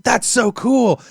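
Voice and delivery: high excited exclamation; excited onlooker. Retold or imitated. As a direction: gush like an amazed guest. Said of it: "people have been like".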